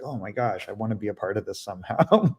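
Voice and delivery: ordinary delivery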